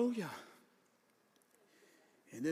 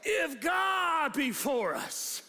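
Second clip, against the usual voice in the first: like a preacher; 4 semitones higher, much slower than usual. Shown as a demonstration. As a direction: preach it out, every word stretched. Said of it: "you gotta say it like a preacher".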